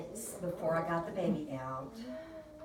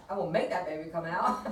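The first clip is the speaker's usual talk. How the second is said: usual speaking voice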